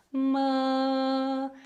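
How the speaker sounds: "ma" sung with rounded lips, open mouth. Shown as a demonstration. Singing teacher shows the rounded mouth shape, rounded tone. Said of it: "esses lábios mais arredondados".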